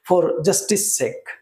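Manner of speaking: slow, deliberate English phrase; teacher dictating slowly; slow example. Shown as a demonstration. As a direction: say it slowly so students catch it. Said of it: "লক্ষ্য করো justice লাস্টে সিই আছে".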